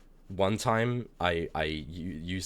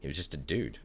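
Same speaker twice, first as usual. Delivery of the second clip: normal talking voice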